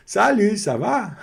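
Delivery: high, chummy French hello; over-familiar greeting. Acted. Teacher mimics a learner greeting a stranger too intimately, over-familiar.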